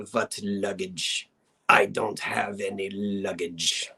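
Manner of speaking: put-on low, very slow Russian accent; haughty Russian princess, deep and deliberate; fierce Russian princess. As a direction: draw it out darkly with a heavy Russian accent. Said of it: "Princess Karagan. She was fierce".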